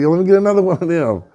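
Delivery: quick, high, eager ask; eager request. Acted. Turkey asking bull for another nugget, eager high voice.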